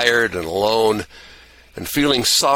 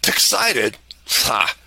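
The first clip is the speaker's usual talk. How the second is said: scoffing; 2 semitones higher, much slower than usual. Acted. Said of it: "I scoffed at him".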